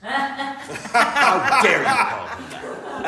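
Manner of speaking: high, loud, drawn-out indignation; hammed-up slow, high cry of outrage; mock outrage. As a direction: imitate an affronted man at full volume. Same outrage repeated, blown up.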